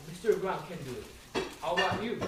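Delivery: usual speaking voice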